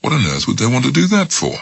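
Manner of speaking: quick, high, puzzled; genuine surprise. Acted. Smith, surprised, answers frightened Mary. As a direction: toss it off in surprise. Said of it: "in genuine surprise".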